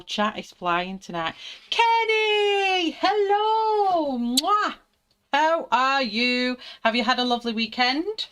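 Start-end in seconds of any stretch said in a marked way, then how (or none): 1.7-8.3 high squealed hello with kiss; excited greeting. Real, not acted. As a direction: gush over a friend arriving. Greeting viewer in chat.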